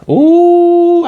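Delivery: high-pitched, drawn-out ooh; long high ooh. Real, not acted. Reacts to the next couple.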